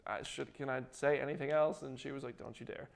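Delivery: softly imitated private exchange; hushed aside. Host retelling an actor checking with his publicist, hushed. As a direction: drop right down for a quoted aside.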